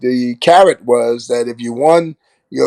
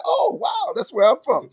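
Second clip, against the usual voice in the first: surprised; 5 dB quieter than usual. Retold or imitated. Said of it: "He said".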